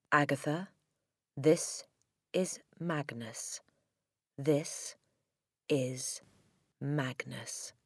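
slow, hushed, word by word; ghostly written message. A narrator's reading. Dead Magnus writing to Agatha, eerily hushed. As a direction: intone it softly with long pauses.

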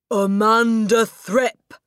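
slow, booming high bellow of a name; furious shout. Acted. Headmistress bellows at a pupil across the playground, shouting. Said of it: "she was shouting".

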